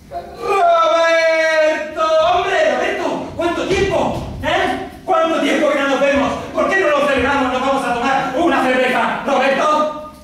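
high, long-drawn hail of a name, then eager chatter; effusive high-pitched acted greeting; hearty calling out. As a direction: greet a long-lost friend at full voice. Acts out hailing Roberto.

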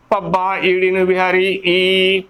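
ordinary talk

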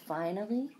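plain reading voice

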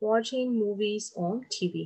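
slow dictation. Shown as a demonstration. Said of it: "watching movie ဖြစ်မယ်နော်".

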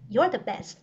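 usual speaking voice

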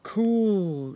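loud, high, delighted; excited boy cheering; excited exclaim. Acted. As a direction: blurt it out thrilled. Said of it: "exclaimed Ned".

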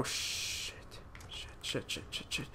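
panicked whisper. Real, not acted. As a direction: hiss the swears so no one hears. Panicked gamer, zombies close in.

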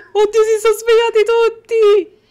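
loud, high panicked cry; panicked shout. Real, not acted. Gamer shouting in panic at waking babies, loud and high. Babies all wake up at once.

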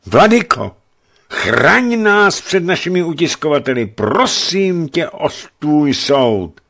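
high, loud, desperate plea, slowing at the end; begging for mercy on his knees; battered boyar begging the bishop for judgement, high and loud; pleading. A narrator's reading. Beaten man begs the bishop.

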